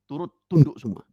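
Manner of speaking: ordinary talk, nothing marked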